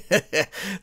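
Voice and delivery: short cocky chuckle; smug villain laugh. Acted. Blinding Skull chuckling at the press conference. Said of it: "The Blinding Skull laughed".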